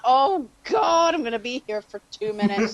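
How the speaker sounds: retold "here we go" exasperation; exasperated dread. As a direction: act out dreading a repeated advert. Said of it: "and I'm like".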